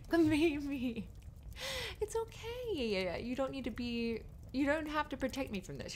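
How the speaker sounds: put-on high-pitched, doting baby talk; cooing reassurance. Comforting protective partner with cuddles.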